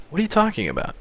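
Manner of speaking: acted question, pitched well up; high character voice asking what's meant; high-voiced questioning. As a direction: ask it high, falling away at the end. Character line, then own echo.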